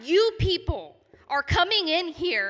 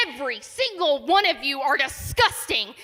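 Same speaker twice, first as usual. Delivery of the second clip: ordinary talk, nothing marked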